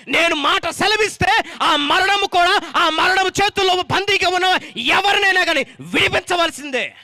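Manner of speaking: loud, rising sermon cry; fiery preaching. Acted. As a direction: proclaim it to the whole hall. Preaching at full force. (బిగ్గరగా ఉద్రేకంతో బోధించడం)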